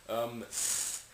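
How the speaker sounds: f as a held rush of air; fricative. Shown as a demonstration. Teacher demonstrating friction with the letter F, airy f. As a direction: blow out f as pure friction. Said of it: "You're making friction".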